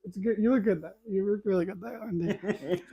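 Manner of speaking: soft, laughing praise for the dog; softly praising the dog Wendy while laughing. Real, not acted. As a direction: coo a little praise at Wendy.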